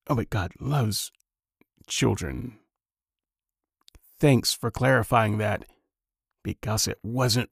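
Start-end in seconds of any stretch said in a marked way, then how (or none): 0.1-2.6 sarcastic aside. Real, not acted. Sarcastic reply to preacher.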